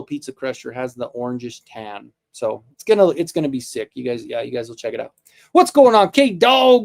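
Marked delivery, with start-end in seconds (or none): 5.5-6.8 loud, high burst of delighted hello; light up and call out the greeting; excited greeting. Real, not acted. Greeting K Dog with a loud, high excited hello. Greeting a pet or kid arriving.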